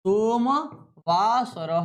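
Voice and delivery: सोमवासरः drawn out very slowly; slow dictation. Shown as a demonstration. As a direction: stretch every syllable of सोमवासरः. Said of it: "क्या हो जाएगा?".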